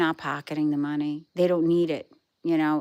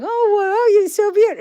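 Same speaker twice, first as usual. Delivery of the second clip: put-on much higher, louder, gushing baby-talk coo; husband cooing at dog; 14 semitones higher, 8 dB louder than usual. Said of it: "I hear him going".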